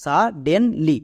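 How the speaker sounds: সা ডেন লি in three separate beats; demonstrating each syllable on its own. Shown as a demonstration. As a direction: break the word into সা, ডেন, লি with small gaps. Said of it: "এবার একসঙ্গে উচ্চারণ করো".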